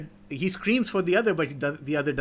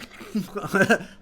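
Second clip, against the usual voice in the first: short acted chuckle at the old man; mocking laugh; 4 semitones higher than usual. Hoodlum laughs at the farmer, short mock chuckle. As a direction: laugh him off lightly. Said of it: "So he starts laughing".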